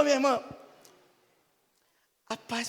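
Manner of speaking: normal talking voice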